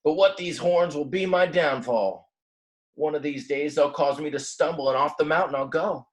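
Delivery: a little ram grumbles he will fall; grumbling ram. Acted. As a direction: grumble out a gloomy prediction. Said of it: "grumbled the little ram".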